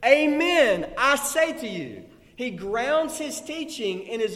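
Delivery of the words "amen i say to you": booming proclamation. Acted. Proclaiming Jesus' amen.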